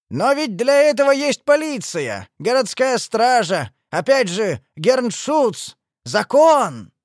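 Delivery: high, loud, indignant objection; exclaimed protest, pitched high. A narrator's reading. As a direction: appeal desperately to reason. Old man protests the lynching.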